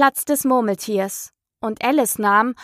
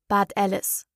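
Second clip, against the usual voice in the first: ordinary delivery, nothing marked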